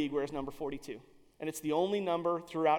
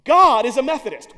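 GOD thrown out loud and proud; booming declaration; 5 semitones higher, 14 dB louder than usual. Retold or imitated. Team owner proclaims to his scouts, booming. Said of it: "is rumored to have said".

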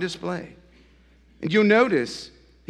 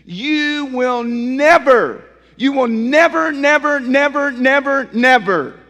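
each "never" hammered louder and higher; repeated insistent never; 11 semitones higher, 12 dB louder, slower than usual. Acted. Pastor warning the congregation, hammering "never" loud. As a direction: pound every "never" like a drumbeat.

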